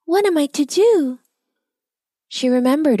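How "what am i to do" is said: high, anxious wondering; worried. A narrator's reading. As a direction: fret aloud, at a loss. Elsie despairs about the witch.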